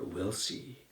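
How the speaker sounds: whispered threat. A narrator's reading. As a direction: whisper it like a plotter biding time. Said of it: "they whisper".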